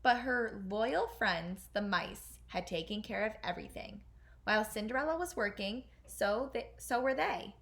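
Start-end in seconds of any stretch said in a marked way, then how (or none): none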